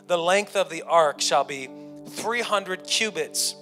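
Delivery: normal talking voice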